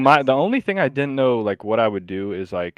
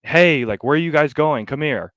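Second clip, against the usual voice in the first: retold yell; 5 semitones higher, 5 dB louder than usual. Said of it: "yelled at them like".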